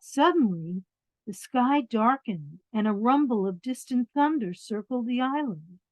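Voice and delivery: lively storybook reading; reading to children. A narrator's reading. Reading a picture book to children, lively storybook voice. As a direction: read it out warmly to the little ones.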